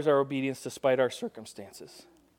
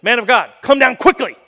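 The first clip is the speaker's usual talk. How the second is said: loud, much higher, cocky demand; cocky captain; 6 semitones higher, 10 dB louder than usual. Acted. Arrogant captain ordering Elijah down, cocky shout. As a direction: bark it like a swaggering officer. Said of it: "This guy's pretty cocky".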